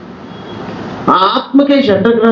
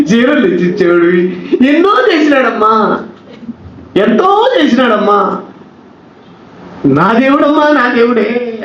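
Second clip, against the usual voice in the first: higher, drawn-out mourning wail; hammed-up widow wailing over him; mock widow's lament; 6 semitones higher than usual. Preacher mimicking widow lamenting her husband, mock wail. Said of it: "అంటూ ఉంటారు".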